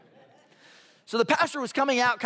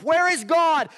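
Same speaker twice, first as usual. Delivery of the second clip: higher, louder and angry on "Where is God?"; louder angry demand; 7 semitones higher, 5 dB louder, slower than usual. A narrator's reading. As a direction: bark "Where is God?" as the pastor loses patience. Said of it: "gets a little louder".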